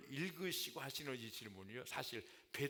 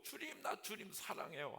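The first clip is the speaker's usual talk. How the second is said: high, eager burst of love; eager cry; 7 semitones higher than usual. Pretend. Peter longing to cry out his love, high and eager. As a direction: blurt it out like you can't hold back. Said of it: "막 외치고 막 소리치고".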